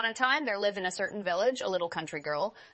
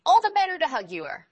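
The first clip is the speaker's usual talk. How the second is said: higher, louder wolf reply; wolf in grandmother's bed answering the girl, higher and louder; wolf disguised as grandmother; 9 semitones higher, 8 dB louder than usual. Acted. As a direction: answer with a sly grin.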